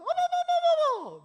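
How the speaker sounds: high startled little squeal; mock startled gasp. Deaconess startled by a small dog, mock squeal. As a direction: play the timid deaconess jumping at the dog.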